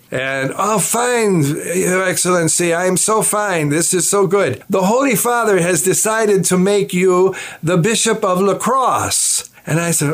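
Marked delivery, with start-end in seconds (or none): 0.6-9.5 high-pitched, effusive imitation of a nuncio; Archbishop Sambi. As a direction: announce good news as an excited church dignitary, pitched up. Acts out nuncio's happy phone call.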